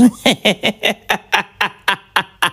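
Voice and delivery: mock cackle; the husband hams up his wife's laugh; imitating wife's laugh. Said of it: "you're laughing like my wife".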